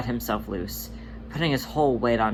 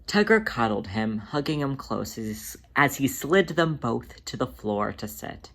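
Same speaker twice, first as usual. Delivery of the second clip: plain reading voice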